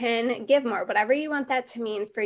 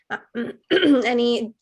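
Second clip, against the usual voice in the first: losing voice; 5 dB louder than usual. Real, not acted. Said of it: "I'm losing my voice".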